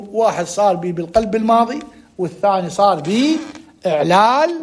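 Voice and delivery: normal talking voice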